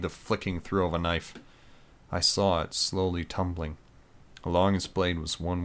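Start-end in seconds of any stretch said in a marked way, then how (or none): none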